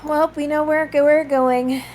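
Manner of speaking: ordinary talk, nothing marked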